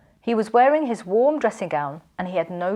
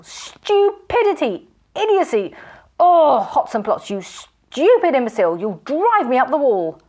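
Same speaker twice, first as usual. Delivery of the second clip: high, loud furious ranting; raging tirade; 6 semitones higher, 5 dB louder than usual. A narrator's reading. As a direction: rant at the absent robber. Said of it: "shouting with rage".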